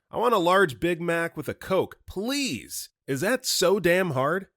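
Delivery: loud, high, exasperated shout. Acted. Customer shouts at the manager through the drive-thru window, shouting. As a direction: shout the order with fed-up impatience. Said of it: "and shouts".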